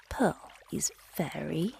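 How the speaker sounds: ordinary delivery, nothing marked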